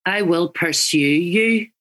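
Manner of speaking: slow Northern Irish, pursue with a dipped you; Northern Irish accent. Shown as a demonstration. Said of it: "Northern Irish".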